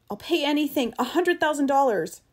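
high, urgent bargaining; desperate plea. Acted. Eccles buying his way back aboard, desperate plea.

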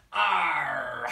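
growled pirate arr. Shown as a demonstration. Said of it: "say the pirate magic words".

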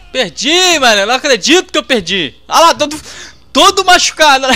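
can't believe he lost; loud disbelief. Real, not acted. Player shocked at losing the round, loud disbelieving cry.

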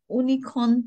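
"Unicorn" without its Y, like "oonicorn"; wrong pronunciation. Shown as a demonstration. Teacher quizzing learners, mispronouncing unicorn as oonicorn. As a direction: begin "Unicorn" on a plain "oo". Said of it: "If we pronounce".

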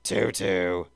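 put-on puzzled childlike Teletubby question. A narrator's reading. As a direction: ask it like a confused Teletubby. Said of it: "Dipsy asked".

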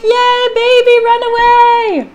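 put-on high, loud cheering for the baby; cheering kids. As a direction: cheer the baby on like excited siblings. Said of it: "They're like".

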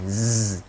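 "z" held as a long buzz; voiced Z. Shown as a demonstration. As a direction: sustain "z" with the voice on. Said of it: "tiếp tục nó là hữu thanh".

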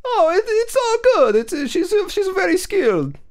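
put-on squeaky high Italian accent voice; high-pitched Italian dad voice. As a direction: play a goofy high-voiced Italian father. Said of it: "your high-pitched voice".

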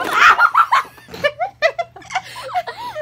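high screaming burst of laughter; shrieking laugh. Real, not acted. Foam bursts at her.

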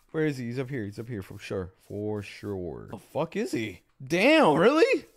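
ordinary talk, nothing marked

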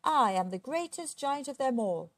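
swaggering storybook giant; boasting. A narrator's reading. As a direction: boast grandly with a high, punched start. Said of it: "he would boast".

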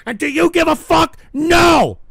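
high, loud rhetorical challenge; angry shouting. Real, not acted. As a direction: fire the question, then bellow the answer. Host berates audience.

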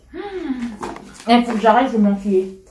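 chewing while talking; talking between bites; mouth full of popcorn. Real, not acted. Seen 0: puts popcorn in mouth at 1.5, chews, takes more.

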